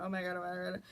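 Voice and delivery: low, soft, repetitive muttering; retold panic, kept quiet and low; muttered panic. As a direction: freak out under your breath. Retold private freak-out after stop.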